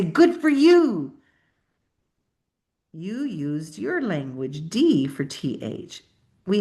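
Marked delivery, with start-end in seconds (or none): 0.0-1.1 slow, high, approving good for you; encouraging praise. Shown as a demonstration. Teacher praising a student's spelling attempt. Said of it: "you're gonna say".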